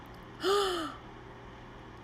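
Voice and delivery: sharp high breathy gasp; shocked intake of breath. Acted. Reader to children, shocked gasp.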